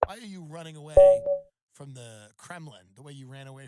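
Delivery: loud, indignant rehearsed challenge; forceful mock confrontation. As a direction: confront the worker with hammed-up outrage. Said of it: "forceful with him".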